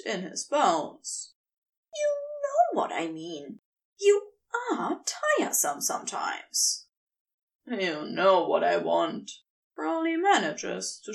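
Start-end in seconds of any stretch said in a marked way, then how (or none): 1.9-6.9 much higher, fading to a tearful whisper; weary angel. A narrator's reading. Aziraphale voiced by narrator. 7.7-9.3 lower, sly and pleased; knowing serpent. A narrator's reading. Crowley voiced by narrator.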